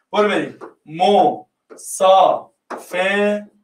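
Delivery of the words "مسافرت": مسافرت stretched out very slowly, syllable by syllable; slowly syllabified. Shown as a demonstration. Teacher splitting مسافرت into syllables for the class, very slow. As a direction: drag مسافرت out so each part is heard.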